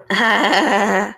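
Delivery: loud, gloating laugh; a scheming trickster laughing loudly at his own cruel joke; villainous cackle. A narrator's reading. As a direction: burst into a wicked, triumphant laugh. Villain gloats over his plan.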